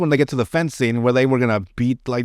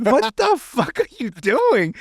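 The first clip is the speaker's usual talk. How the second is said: laughing disbelief; 9 semitones higher, slower than usual. Retold or imitated. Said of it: "And I'm like".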